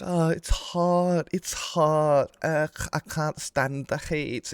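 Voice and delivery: high, slow whiny moaning about the heat; mock whiny complaint. Teasing viewers by mimicking them whining about heat, slow high whine. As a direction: whine it slowly, mocking the chat. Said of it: "You're like".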